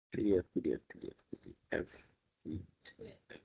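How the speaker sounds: very soft self-talk, fading almost to nothing; absent-minded muttering. Real, not acted. Teacher hunting for the questions file, muttering to self.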